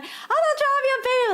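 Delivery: fast, high-pitched mock protest; imitated offended learner. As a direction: blurt it indignantly, way up high. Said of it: "they get so offended".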